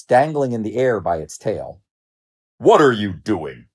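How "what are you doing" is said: slow, menacing lion growl. A narrator's reading. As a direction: growl it slowly at the culprit. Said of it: "growled the lion".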